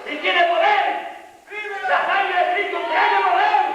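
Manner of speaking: high, driving pulpit shout; impassioned high-pitched sermon cry; full-force preaching. Real, not acted. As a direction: drive each phrase home at a shout.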